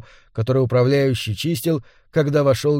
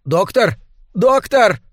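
urgent high call, louder the second time; alarmed insistent call; 7 semitones higher, 4 dB louder, slower than usual. A narrator's reading. Servant calling the sleeping doctor from the darkness, alarmed and insistent. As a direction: call out in alarm to rouse someone. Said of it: "послышался встревоженный голос".

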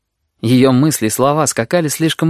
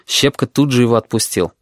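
usual speaking voice

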